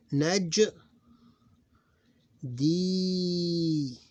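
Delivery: loud high "Naj", then long-held "D"; syllable by syllable. Shown as a demonstration. Teacher demonstrating a word to students, drawn-out syllables. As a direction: split "Naj, D" and stretch the D.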